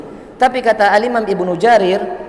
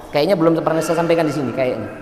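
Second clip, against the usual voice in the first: ordinary talk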